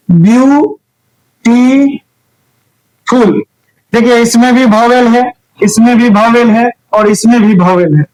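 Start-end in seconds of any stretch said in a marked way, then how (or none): 0.1-3.4 ब्यू, टी, फुल as separate beats with pauses; split into syllables. Shown as a demonstration. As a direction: tap out each syllable of ब्यूटीफुल. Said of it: "आप इसका खंड जब कीजिएगा तो कैसे होगा".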